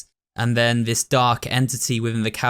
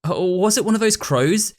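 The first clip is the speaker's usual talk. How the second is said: put-on higher, annoyed kid voice; grumbling friend; 8 semitones higher than usual. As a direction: complain about the noisy crows.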